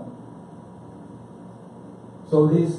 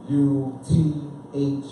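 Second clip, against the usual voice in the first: usual speaking voice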